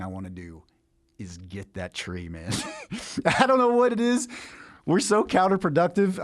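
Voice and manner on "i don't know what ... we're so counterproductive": high laughing words, louder. Real, not acted. Preacher laughing at his own urge to grab the fruit. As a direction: laugh through it at your own weakness.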